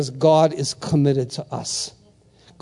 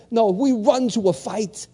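ordinary talk, nothing marked

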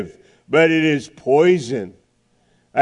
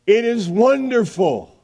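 put-on an old man amazed, stretching it out; old man marveling; 7 semitones higher than usual. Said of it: "to be an old man and to say".

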